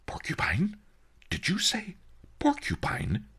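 hushed, high, incredulous question; astonished. A narrator's reading. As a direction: echo it in awed disbelief. Merchant astonished at the word porcupine.

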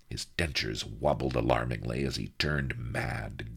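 usual speaking voice

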